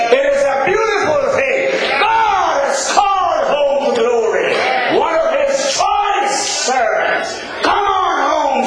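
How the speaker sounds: rising, shouted funeral sermon; preaching at full force. Acted. Preacher lifting the mourners at a funeral, full force. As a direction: build to a ringing shout over the grave.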